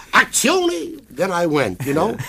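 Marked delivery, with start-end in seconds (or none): 0.1-1.0 loud, high Italian cry of Azione; Italian director. Retold or imitated. Director calling the scene, loud Italian cry. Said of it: "Italian director's cry repeated".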